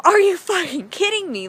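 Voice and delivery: loud, high acted outrage; incredulous protest. Storyteller arguing with the voice in her head, loud incredulous protest. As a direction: burst out in mock indignation.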